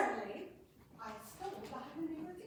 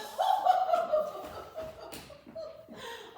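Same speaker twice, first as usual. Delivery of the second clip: loud, high helpless laugh; burst of laughter; 12 dB louder than usual. Acted. Courtier arrives laughing hard before his news, loud laugh. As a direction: break into a shrieking laugh. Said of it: "Oh, I am stabbed with laughter".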